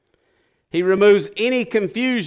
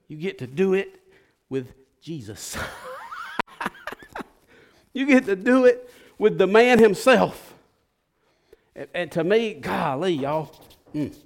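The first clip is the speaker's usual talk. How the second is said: normal talking voice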